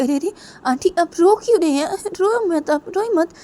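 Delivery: high-pitched, flustered consoling; alarmed concern. Acted. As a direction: react with sudden worry at someone's tears. Alarmed at aunt's tears. (घबराकर चुप कराना)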